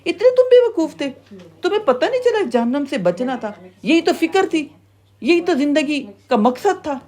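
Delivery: high-pitched indignant berating; scolding gatekeeper. Acted. Keeper of Hell berating fools who failed to save themselves, indignant scolding. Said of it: "جہنم کا داروغہ کہے گا".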